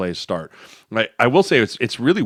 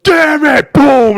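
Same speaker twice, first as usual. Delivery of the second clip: much higher, much louder mock explosion; furious yell; 11 dB louder, much slower than usual. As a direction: blow up like a coach finally losing it. Coach exploding in meeting room.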